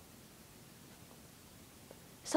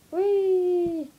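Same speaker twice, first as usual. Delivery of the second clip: excited; 6 semitones higher, 3 dB louder than usual. Acted. Gleeful squeal.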